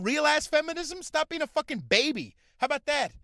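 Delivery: loud, high-pitched scolding tirade; angry rant. Real, not acted. Scene: comic scolding women who can't take catcalls, angry rant. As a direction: berate them at full volume, worked up. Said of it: "rant that f-, long at her".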